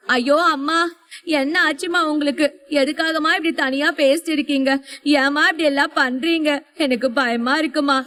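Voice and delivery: put-on small girl's high pleading wail; a scared little girl begging her mother; crying child. A narrator's reading. Little daughter cries to her dazed mother, high and pleading. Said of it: "என சொல்லிக்கொண்டே கதறினாள்".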